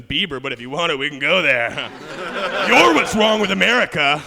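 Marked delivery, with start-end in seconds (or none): 2.7-4.2 loud laughing jab; hammed-up loud insult; mock outburst. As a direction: throw it at them, half laughing. Mock heckling the Canadians.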